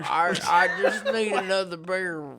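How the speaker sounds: loud, hammed-up Southern drunk; drunk Southern accent. Drunk asking for another beer, in a Southern accent. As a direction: play a boozy Southerner through a laugh.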